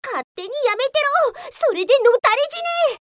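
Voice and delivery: high, sharp, angry snap; angry retort. Acted. Office police snaps angrily at the quitting worker. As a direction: spit it out in a jealous rage. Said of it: "何を怒ってるんです？".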